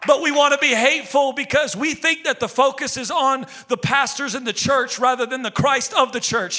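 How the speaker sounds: loud, high, driving sermon; fiery preaching. Acted. Pastor rebukes the church from the pulpit, fiery preaching. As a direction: preach it hard to the congregation.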